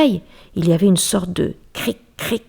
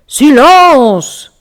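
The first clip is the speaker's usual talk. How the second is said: loud, higher angry roar; bear's roar; 8 semitones higher, 13 dB louder than usual. Acted. Bear losing patience with the mouse, loud roar. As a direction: roar for silence.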